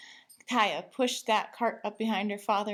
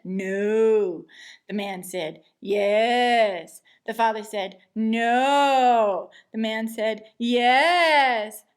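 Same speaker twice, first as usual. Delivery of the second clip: louder and louder flat no; stubborn refusal; 8 dB louder, much slower than usual. A narrator's reading. Father and cashier arguing, getting louder. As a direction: dig in with each reply. Escalating shouting match.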